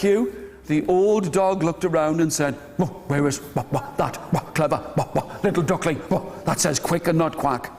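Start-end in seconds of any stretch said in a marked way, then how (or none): 2.8-7.7 low, woofing dog voice; low-pitched old dog, barking between phrases. Acted. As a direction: talk low with woofs between words. Old dog speaks between woofs.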